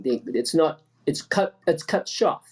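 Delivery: ordinary talk, nothing marked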